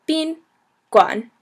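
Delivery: "Bin", pause, "guan"; syllable-by-syllable pronunciation. Shown as a demonstration. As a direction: model "Bin guan" for a learner. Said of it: "and then on".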